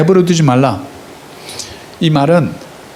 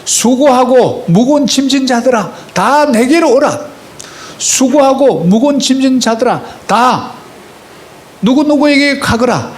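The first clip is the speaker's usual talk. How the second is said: a slow, higher, gently calling voice; voice of Jesus; 7 semitones higher than usual. Acted. Pastor voicing Jesus inviting the weary, lightly higher and slow. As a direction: call the weary to come, slowly. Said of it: "예수님도 뭐라 그럽니까".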